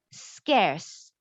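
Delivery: "Scarce" said with a clear S sound at the start; pronunciation model. Shown as a demonstration. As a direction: say "Scarce" slowly, skairs, with a crisp S. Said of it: "This word is pronounced as scarce".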